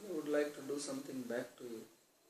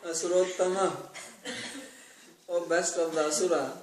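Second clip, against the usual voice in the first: louder, laughing through the words; 3 semitones higher, 7 dB louder than usual. Real, not acted. Preacher quoting the Lord to the devotees, laughing loudly.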